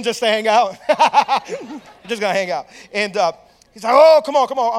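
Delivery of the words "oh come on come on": loud, higher pumped-up egging on; mock gym-buddy hype, starting big; imitated urging. Imitating friend urging the others on.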